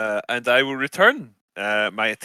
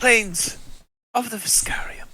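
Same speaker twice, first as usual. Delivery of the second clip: high breathy documentary whisper; hushed awe over the wild plains; nature documentary; 12 semitones higher than usual. Pretend. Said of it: "Is this wildlife documentary".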